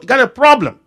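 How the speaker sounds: high, loud hammered warning; fiery preacher's punchline; preaching shout. Acted. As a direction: drive the warning home from the pulpit. Repeat, preacher raises voice.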